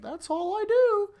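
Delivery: very high, loud, slow squeal; exaggerated girl character. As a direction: squeak it out as a hammed-up heroine. Voicing girl's reply in story.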